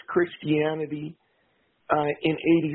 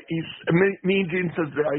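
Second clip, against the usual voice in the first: usual speaking voice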